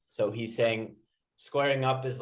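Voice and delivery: ordinary talk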